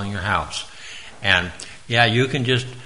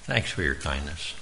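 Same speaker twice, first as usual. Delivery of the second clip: quiet, lower, gentle thank you; soft sincere thanks; 6 semitones lower, 7 dB quieter than usual. Shown as a demonstration. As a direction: say it softly as you leave the door. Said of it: "We could say".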